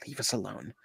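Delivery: normal talking voice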